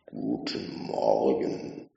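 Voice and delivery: low, slow machine greeting; slow and low, like a vast computer; deep slow computer voice. A narrator's reading. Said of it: "sagte Deep Thought".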